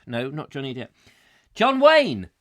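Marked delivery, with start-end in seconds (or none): 1.5-2.3 triumphant recall. Real, not acted. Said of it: "Thank you".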